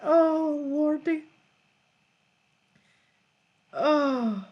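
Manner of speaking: normal talking voice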